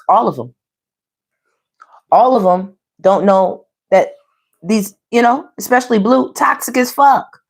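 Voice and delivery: ordinary delivery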